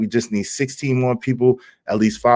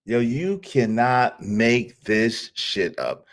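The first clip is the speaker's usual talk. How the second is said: slow, softer, word-by-word amazement; slow disbelief; 5 dB quieter, much slower than usual. Real, not acted. Streamer to viewers after laughing, slow disbelief. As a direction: spell out "cannot make this shit up" slowly.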